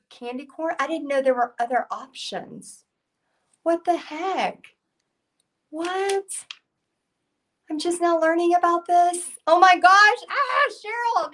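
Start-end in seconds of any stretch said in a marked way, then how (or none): 3.6-6.2 high, drawn-out disbelief; real surprise, pitched way up; stunned at unexpected news; astonished. Real, not acted. Astonished by s'mores candy corn news. 7.7-11.3 high, loud burst of excitement; thrilled outburst at a new discovery; so excited. Real, not acted. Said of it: "I got so excited about the s'mores".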